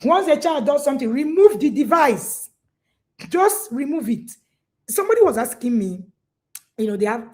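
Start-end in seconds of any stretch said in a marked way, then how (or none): 1.1-4.3 mock coach advice. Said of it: "you see a parent coach, they will tell you".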